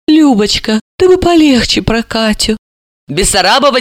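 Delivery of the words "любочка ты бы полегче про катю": humbly pleading. Acted. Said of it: "снова униженно попросила".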